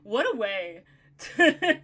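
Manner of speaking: loud, slow, delighted talk through laughter; real laughter breaking into the words; laughing with delight. Real, not acted. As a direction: gush happily while cracking up. Delighted laughter after co-host's voice.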